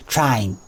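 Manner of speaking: "train" widened to "trine"; mock "train" with an "eye" glide; "train" said like "try-een"; mocked mispronunciation. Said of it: "You probably say it like T R A E N".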